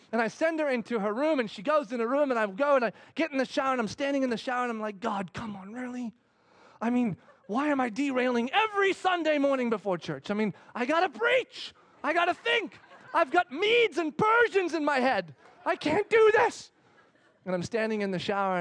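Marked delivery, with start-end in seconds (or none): 5.0-16.7 exasperated prayer. Retold or imitated. Complaining to God in shower.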